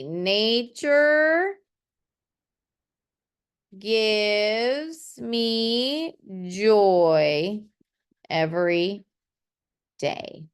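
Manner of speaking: drawn-out, very slow reading of a finished poem line. Shown as a demonstration. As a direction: stretch each word like reading the poem aloud to the class. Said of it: "So that's what it's gonna be".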